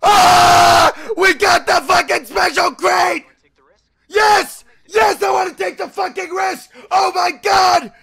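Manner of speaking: high, loud celebratory shouts; ecstatic screaming. Real, not acted. Player celebrating the special crate, ecstatic screaming. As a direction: scream it in wild excitement.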